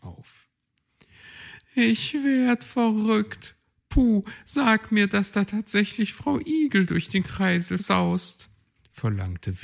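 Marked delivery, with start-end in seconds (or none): 1.7-8.5 astonished. Acted. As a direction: gasp out your disbelief, high. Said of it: "staunte Willi Kautz".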